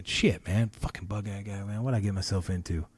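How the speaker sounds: very soft, muttered to himself; inner thought. Retold or imitated. Comic recalling his panic before going on stage, hushed. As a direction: think it under your breath in dread. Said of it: "I'm thinking".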